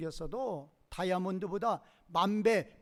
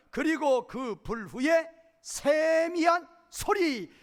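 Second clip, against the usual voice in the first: scripture reading; 4 semitones higher, slower than usual. Acted. Reading the scripture verse slowly.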